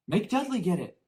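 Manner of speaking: high, loud spoiled whine; high loud spoiled son; whiny boy. A narrator's reading. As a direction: push the chore off. Harry mimicking Dudley.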